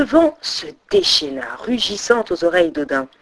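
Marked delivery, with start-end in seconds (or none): none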